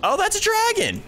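sudden high yelp at the dragon; startled cry spotting a dragon; surprised. Real, not acted. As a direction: shoot up in pitch, startled.

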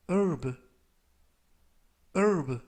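herb said as "urb" with no h sound; silent h demo. Shown as a demonstration. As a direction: say herb starting on the vowel, h dropped. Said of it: "라고 발음하시면 되겠고요". (H 묵음 발음 시범)

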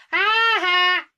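high, loud mocking bully laugh; Nelson. Vlogger imitating a famous bully's laugh, high and loud. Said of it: "another one I just done is Bully Nelson".

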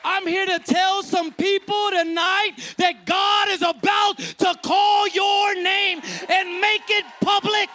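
loud, rising preacher's cry; full-force preaching. Real, not acted. Preacher rousing the congregation at full force.

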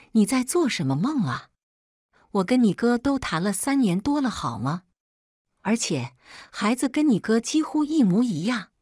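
mildly acted, incredulous put-down; scornful retort. A narrator's reading. Mother bursting her ex's fantasy about the child, scornful retort.

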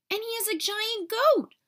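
put-on excited boyish shout. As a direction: yell the funny fact. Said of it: "Ollie shouted".

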